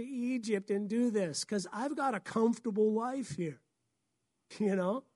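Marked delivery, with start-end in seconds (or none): none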